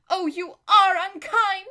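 high, loud, drawn-out whine; whining reproach. Acted. Lazareline reproaching her unkind friend, slow high whining. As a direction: wail it like a wounded princess.

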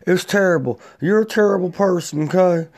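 hammed-up talk-show host dressing him down; mock Dr. Phil scolding. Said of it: "And Doctor Phil's like".

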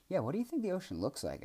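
high, eager little creature voice; excited. A narrator's reading. Young Boojum asking Kellen about the ocean, excited and high. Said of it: "asked Boojum excitedly".

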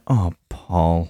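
hushed mock-motherly reaction; imagined mum. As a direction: say it softly as a dismayed mother. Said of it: "your mum went".

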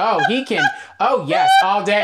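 imitating a daughter gushing over him; mock swooning daughter. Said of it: "she's like, "My daughter goes".

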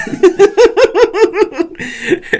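loud, very high cackling laugh; real burst of laughter. Real, not acted. Co-host reacting to a listener's message, real laughter. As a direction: crack up loudly and helplessly.

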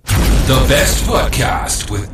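slow, loud show-title announcement; announcer voice. Acted. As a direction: boom out the show name slowly. Podcast jingle tag.

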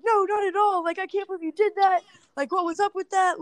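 high-pitched mock protest; retold incredulous reply; imitated disbelief. Imitating pranked friend's startled reply.

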